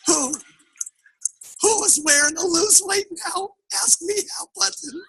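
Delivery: tiny, high, soft squeak; hammed-up meek little voice; sheepish wife. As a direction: trail into a small high-pitched confession. Wife sheepishly confesses to husband.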